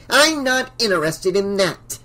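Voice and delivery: sharp, dismissive retort; shot back. A narrator's reading. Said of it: "Missus Deagle shot back".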